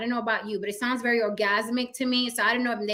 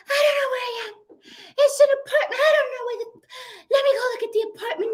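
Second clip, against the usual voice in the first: mock crying plea, much higher, with a whispered sob; frantic breathless woman begging for help; hysterical panic; 12 semitones higher than usual. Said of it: "She was a little breathy".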